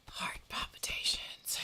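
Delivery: voiceless, dramatic whisper after a pause; stricken whisper. Acted. Telling viewers of her glitter horror, stricken whisper. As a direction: whisper it as if overcome. Mock distress over the glitter.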